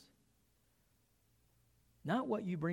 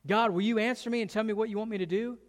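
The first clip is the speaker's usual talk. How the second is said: higher, louder, hurried plea; begging; 5 semitones higher, 5 dB louder, faster than usual. Retold or imitated. Saul begging God to answer him, pleading. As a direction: plead urgently in a rising rush. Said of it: "Saul is begging God".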